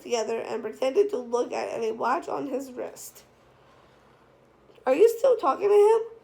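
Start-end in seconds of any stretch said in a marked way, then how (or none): 4.9-6.2 higher and louder, alarmed question; startled worry breaking out all at once; frightened. Acted. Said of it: "with a frightened tone".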